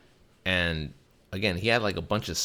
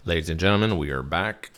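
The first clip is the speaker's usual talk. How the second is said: louder announcer-style opener; host announcement; 5 dB louder than usual. Real, not acted. Podcast host welcoming listeners back, announcer voice. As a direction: open it up like a show host.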